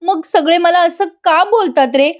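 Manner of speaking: acted whine of a wronged wife; mock tearful plea. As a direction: plead like a wife putting on tears. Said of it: "ती आता रडायला लागते".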